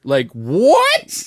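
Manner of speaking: ordinary talk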